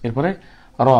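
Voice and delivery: normal talking voice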